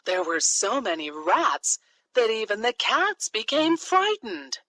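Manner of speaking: ordinary delivery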